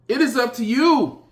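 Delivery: high and loud, a hard fall at the end; shouted. Real, not acted. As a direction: throw it at them with full force. Repeated line, first one shouted.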